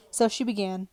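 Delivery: ordinary delivery, nothing marked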